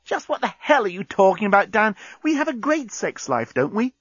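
high-pitched indignant woman; narrator's cross, high Lucy voice; getting mad. A narrator's reading. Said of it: "Lucy was getting mad at him".

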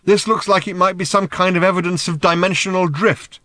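high, loud and sustained; shouted explanation. A narrator's reading. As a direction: explain at a shout over the din. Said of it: "shouted Ford".

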